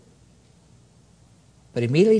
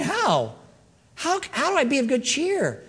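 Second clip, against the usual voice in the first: baffled questioning; 8 semitones higher, 3 dB quieter than usual. Acted. Acting baffled believer questioning Lord.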